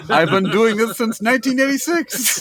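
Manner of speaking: high voice cracking up with laughter; mock boasting chef. Chef bragging about his bawdy trick, mock boasting. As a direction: proudly call it out while laughing.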